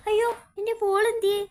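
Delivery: high, loud and fast, oh no where's my ball; alarmed cry. Acted. Child discovering her ball is gone, loud alarmed cry. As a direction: blurt it out in a panic.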